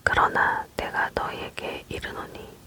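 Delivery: plain reading voice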